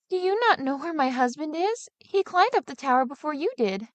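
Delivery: a wife anxious for her husband; uneasy wife. Acted. Sexton's wife voiced.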